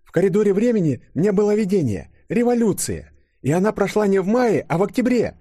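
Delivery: high-pitched, insistent female character voice; Yulia recounting a vision. Acted. Yulia telling the chief her vision, high and insistent. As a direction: report it urgently in a high voice.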